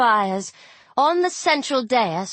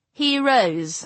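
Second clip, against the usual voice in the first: plain reading voice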